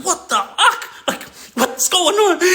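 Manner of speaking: high, slow, stunned imitation of the boys; shocked reaction. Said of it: "all my guys were just like".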